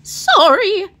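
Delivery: a startled maid gasping it out; Amelia Bedelia voice. Acted. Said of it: "said Amelia Bedelia".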